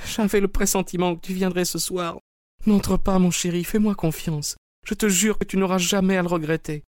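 high, urgent woman's voice begging; pleading wife. Acted. Wife imploring her husband to stay out, high pleading. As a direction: beg him to trust you, voice held high.

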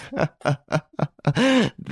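high acted belly laugh; roaring laughter. A narrator's reading. Devan laughs at the stolen watch, uproarious. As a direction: burst out in uncontrollable laughter. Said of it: "He roared with laughter".

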